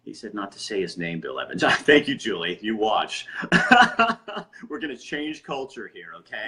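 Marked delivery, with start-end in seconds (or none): 3.3-4.6 bursting into a loud, high laugh. Real, not acted. Laughs at a commenter.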